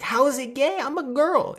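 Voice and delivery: very high, loud put-on girl voice; indignant girl. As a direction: squeal your protest in falsetto. Girl protests indignantly in story.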